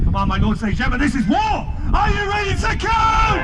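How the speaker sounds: rally the crowd like a showman; rousing crowd shout. Real, not acted. Presenter rousing crowd for countdown.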